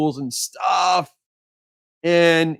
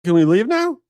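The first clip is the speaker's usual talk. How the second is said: awkward ask; 7 semitones higher, 5 dB louder than usual. Retold or imitated. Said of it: "everyone does the awkward".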